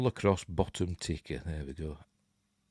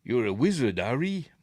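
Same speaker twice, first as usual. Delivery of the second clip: Hagrid; 2 semitones higher than usual. Retold or imitated. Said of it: "Hagrid, was that you?".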